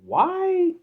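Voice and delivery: a sudden high shout of disbelief; outraged yell. Real, not acted. Fan yelling at the coach about overtime, outraged. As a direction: explode on the word in outrage.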